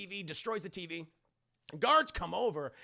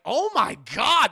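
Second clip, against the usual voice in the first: loud, drawn-out mock gasp of disbelief; big imitated shock; shocked crowd; 4 semitones higher, 12 dB louder, slower than usual. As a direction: exclaim it like an appalled onlooker. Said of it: "Everyone's like".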